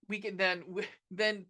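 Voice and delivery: ordinary delivery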